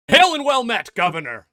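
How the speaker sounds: Rockthor greeting. Retold or imitated. Host imitates how Rockthor would greet. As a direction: boom it like a fantasy warrior. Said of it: "like Rockthor".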